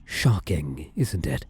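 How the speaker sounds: ordinary talk, nothing marked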